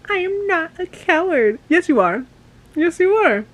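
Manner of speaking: high, loud back-and-forth argument; heated quarrel. Acted. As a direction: shout the retort in a fight. Acted quarrel between characters.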